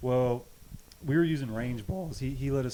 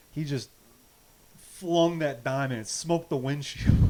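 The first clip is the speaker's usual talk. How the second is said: ordinary talk, nothing marked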